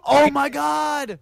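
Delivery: high, slow gasped oh my God; gasping surprise. Real, not acted. Friend gasping at mention of the cheese guy. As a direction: gasp as if it's huge news.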